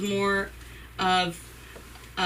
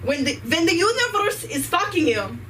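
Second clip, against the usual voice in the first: higher, hammed-up Ukrainian accent; mock Ukrainian guru voice, pitched up; 8 semitones higher than usual. Comic teaching the crowd the mantra in a hammed-up Ukrainian accent. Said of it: "in a Ukrainian accent".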